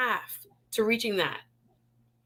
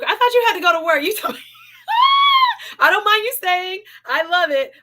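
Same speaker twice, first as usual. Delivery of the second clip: louder, higher, teasing laugh in the voice; playful tease; 10 semitones higher, 8 dB louder, faster than usual. Real, not acted. Host teasing a viewer who should be at work, playful. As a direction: call out the viewer with delight.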